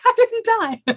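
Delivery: high, giddy squeal; reliving the giddy relief. Retold or imitated. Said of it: "I'm watching myself be all giddy".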